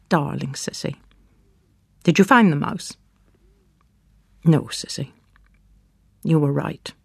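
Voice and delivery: usual speaking voice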